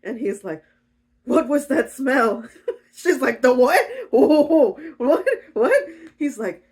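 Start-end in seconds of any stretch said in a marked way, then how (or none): 1.2-2.5 loud, high, slow questioning; hammed-up bewildered outburst; distressed demand. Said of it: "goes into her room distressed". 3.4-5.8 loud, high startled whats; hammed-up panicked surprise; flustered shock. Rhea caught out, flustered.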